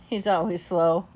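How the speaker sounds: ordinary delivery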